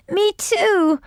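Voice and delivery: high little cat. A narrator's reading. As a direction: chime in eagerly. Cat agreeing.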